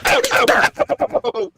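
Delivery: high repeated yelps; mock pain yelps. Husband swatted with a newspaper, high mock yelps. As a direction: yelp as if being hit. Acting being hit.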